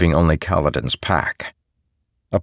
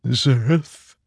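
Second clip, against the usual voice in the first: higher, frail little voice; frail sword voice; 8 semitones higher than usual. Acted. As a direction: speak weak and fragile. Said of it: "a quiet, frail voice".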